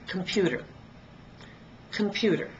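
computer stressed on pu; teacher showing the stress in computer; stress on the middle. Shown as a demonstration. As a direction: put the beat on pu in computer. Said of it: "I only stress one of them".

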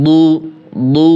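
"দু" with a thick, heavy d; heavy dad. Shown as a demonstration. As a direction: drill "দু" as a full-mouthed Arabic dad. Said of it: "দ হরফ মোটা". ("দু" মোটা দ্বদ দিয়ে)

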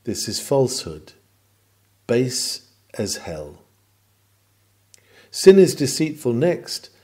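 ordinary talk, nothing marked

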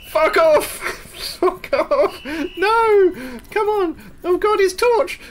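high, loud yelling in alarm; real startled, high-pitched panic; panicked shouting. Real, not acted. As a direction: scream at the thing chasing you. Caught by pursuer in game.

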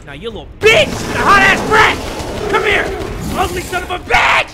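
high, loud taunting shouts; combat yelling. Real, not acted. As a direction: yell insults at the attacker. Fighting the enemy.

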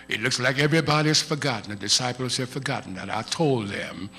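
a hint of a weary Jesus, soft and low; quiet, inward, hurt that they forgot; Jesus speaking. Preacher voices Jesus.

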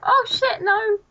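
high, loud cry of alarm; dismayed cry. Real, not acted. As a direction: cry out high as disaster strikes. Loses items in the game.

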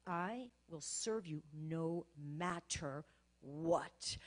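each word drawn out slowly and weighed; very slow prayer. Shown as a demonstration. Woman praying alone in her prayer room, very slow earnest vow. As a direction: pray it slowly, thinking through every word. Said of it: "when you talk that slow".

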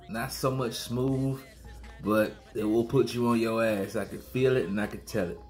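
usual speaking voice

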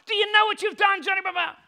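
loud, high outburst at his son; angry scolding. Retold or imitated. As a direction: shout the question in frustration. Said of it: "I'm getting quite angry and frustrated at him".

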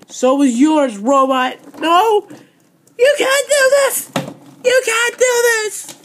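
high, loud, frantic screams at full force; hysterical screaming. Acted. Champion shrieks in a panic as her belt is taken. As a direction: shriek like a tantrum that won't stop.

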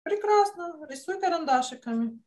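usual speaking voice

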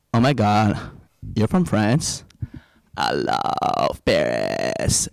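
mock gushing, slow and drawn out; awestruck American woman. Woman swooning on hearing he is from France, mock awestruck. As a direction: drop low and stretch it in dreamy awe.

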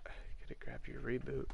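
talking with a plugged nose; nose stuffed with paper; tissue up one nostril. Real, not acted. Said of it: "I'm plugging more toilet paper in my nose".

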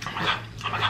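breathless whispered oh my God; awed whisper. Real, not acted. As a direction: whisper it, overcome by the food. Overwhelmed by the taste.

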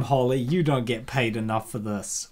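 ordinary talk

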